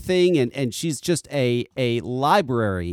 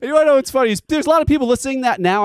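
excited; 10 semitones higher, 6 dB louder, much faster than usual. Real, not acted. Excited, amused aside.

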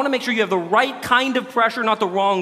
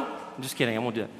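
hushed joking aside; 9 semitones lower, 11 dB quieter, much faster than usual. Real, not acted. Preacher quietly takes back his World Cup tease, hushed aside. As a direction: drop to a soft, low aside.

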